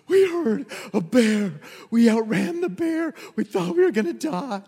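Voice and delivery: gasping, high-pitched frantic report; acted breathless panic. Runners telling the church family of the bear, acted breathless panic. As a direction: gasp out the news between mock heaving breaths.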